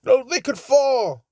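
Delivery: high, loud British protest; lightly voiced British complaint; British accent. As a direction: object sharply in a British accent. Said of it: "I made him fucking British".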